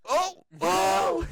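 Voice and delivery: high excited oh oh oh; excited yell. Retold or imitated. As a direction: yelp it out in thrilled disbelief. Reenacting excited call.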